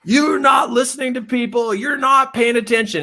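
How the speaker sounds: high, shouted boss voice; imagined boss yelling. Retold or imitated. The boss in his head yelling at him, shouted. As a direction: bark it like the angry boss. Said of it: "my boss still yelling at me".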